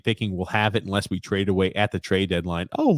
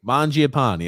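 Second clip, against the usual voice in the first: usual speaking voice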